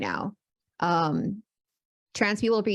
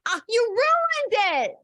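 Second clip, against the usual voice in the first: high, drawn-out mock wail; hammed-up cry of protest, slow and high; mock outrage; 4 dB louder, slower than usual. Co-host scolds partner for spoiling the reveal, in mock outrage.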